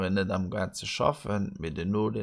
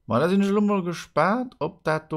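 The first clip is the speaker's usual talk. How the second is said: excited; 11 semitones higher, 8 dB louder than usual. Acted. Excited build-up before magic trick.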